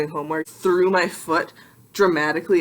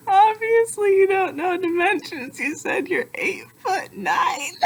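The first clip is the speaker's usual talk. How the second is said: much higher, sarcastic squeaky scold; mocking high voice; 14 semitones higher, slower than usual. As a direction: scold yourself in a shrill mocking voice. Mocking her own claim in high voice.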